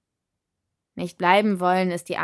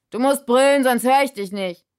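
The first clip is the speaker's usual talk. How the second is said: loud, higher shout over the noise; 6 semitones higher, 7 dB louder than usual. A narrator's reading. Spirit telling her to shout over the crowd, loud. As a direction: yell over the music.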